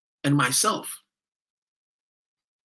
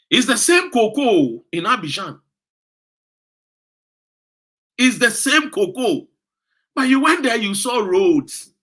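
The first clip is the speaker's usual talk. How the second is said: indignant; 4 semitones higher, 6 dB louder than usual. Real, not acted. Scolding voters about Abidjan.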